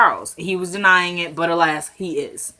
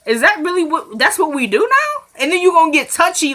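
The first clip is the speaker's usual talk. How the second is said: higher, exasperated callout of a hypocrite; rising, higher disbelief rant at the character; indignant rant; 4 semitones higher than usual. Real, not acted. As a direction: call her out, fed up and incredulous.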